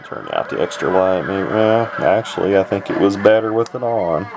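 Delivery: ordinary talk, nothing marked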